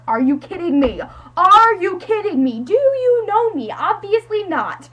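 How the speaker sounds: incredulous, building from loud to a high yell; hammed-up indignant shouting, pitch climbing; mock outrage. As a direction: escalate into slow, loud disbelief. Acting out outraged reply to Becca.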